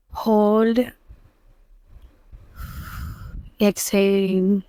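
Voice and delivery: very soft, slow meditation voice with long pauses; hushed Islamic meditation, breath by breath; guided meditation. Acted. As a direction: guide them gently into calm, hushed and unhurried, drawing out the last words.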